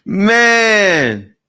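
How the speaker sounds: a loud stunned burst; loud exclamation. Real, not acted. Reacting to the clip with a loud stunned shout.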